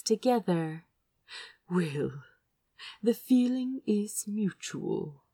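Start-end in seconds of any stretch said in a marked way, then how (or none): 1.7-5.2 softer, tender reply to a lover. Acted. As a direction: say it softly, foreheads together.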